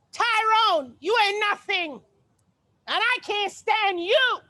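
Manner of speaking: high, loud sassy scolding. Acted. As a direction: tell Tyrone off, loud and high.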